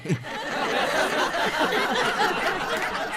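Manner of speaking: breathy laugh. Real, not acted. Laugh after punchline.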